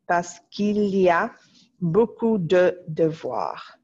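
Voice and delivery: "parce qu'il y a beaucoup de devoirs" read slowly for dictation. Shown as a demonstration. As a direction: read it slowly for the class to write. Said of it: "this sound is qu'il".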